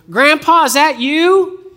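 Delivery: high, loud Southern drawl, stretched out. Retold or imitated. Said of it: "a younger voice with kind of a".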